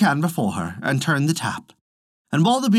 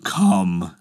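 lower, firm call; a hint of a decisive groom; Hans; 7 semitones lower than usual. A narrator's reading. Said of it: "said Hans".

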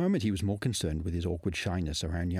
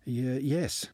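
stammered; 6 semitones higher than usual. A narrator's reading. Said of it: "he stammered".

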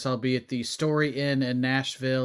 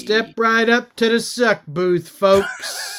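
loud, higher carnival barker; ringmaster; 6 semitones higher, 5 dB louder than usual. Acted.

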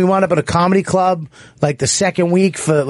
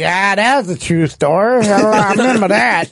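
slurred, drawn-out heckle, pitched up; heckling homeless guy; 5 semitones higher than usual. Retold or imitated. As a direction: imitate a homeless man calling out mid-act. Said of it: "he'd be like".

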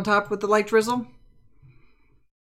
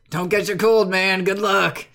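calling out; 5 dB louder, slower than usual. Acted. Ezekiel calls out while leaving.

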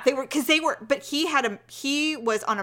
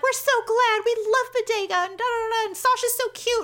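much higher, sing-song gushing; gushing neighbors; 11 semitones higher than usual. Retold or imitated. Retelling the neighbors fussing over the dogs, much higher. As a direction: gush like relieved neighbors. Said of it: "We're like".

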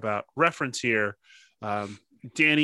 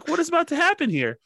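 excited; 4 dB louder, faster than usual. Retold or imitated. Excited retelling, laughing.

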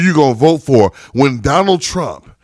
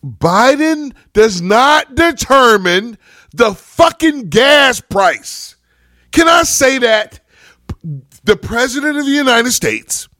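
raised voice, venting real anger; angry outburst; 6 semitones higher, slower than usual. Real, not acted. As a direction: let the frustration boil over. Angry rant about gas prices.

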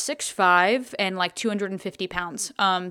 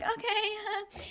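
small, high, soft okay; uneasy okay; 12 semitones higher, 8 dB quieter than usual. Retold or imitated. Girl answering an unwanted request to meet behind bleachers, uneasy. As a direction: squeak out a reluctant okay.

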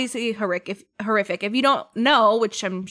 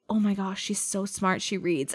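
mock awed hush; 2 semitones lower, 7 dB quieter than usual. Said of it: "he was like".

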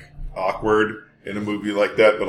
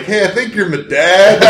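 high, slow mock-British voice; loud, drawn-out pretend British caller; 9 semitones higher, 6 dB louder than usual. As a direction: break the news as a comic British stranger. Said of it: "forty-seven-year-old British guy".

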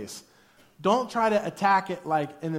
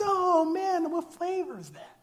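high, casual fake nonchalance; high, breezy innocent question after getting caught; mock innocent cover-up; 11 semitones higher than usual. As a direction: pretend you weren't staring. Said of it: "And I went".

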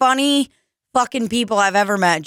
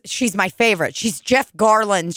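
usual speaking voice

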